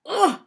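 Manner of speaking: high, loud grunt of disgust; disgusted ugh. A narrator's reading. Frosty reacts to the girl throwing up, loud disgusted ugh. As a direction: burst out revolted.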